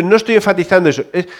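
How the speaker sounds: normal talking voice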